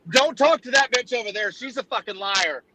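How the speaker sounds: high, urgent farmer warning; hammed-up frantic farmer; panicked farmer. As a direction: blurt it out to stop him. Farmer snaps in punchline.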